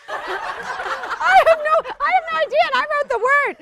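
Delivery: high, slow, giggling through it; host cracking up at her own word; laughing. Real, not acted. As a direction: laugh while confessing.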